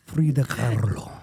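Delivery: low Mexican-accented name with rolled R; put-on Mexican accent. Shown as a demonstration. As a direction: roll it like a Mexican Spanish speaker. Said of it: "put that Mexican accent".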